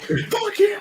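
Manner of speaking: high, strained mock yell from the crowd; audience yelling. Fan in the theater yelling at the villain, high mock yell.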